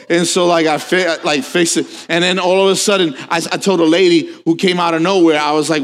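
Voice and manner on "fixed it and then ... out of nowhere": ordinary talk, nothing marked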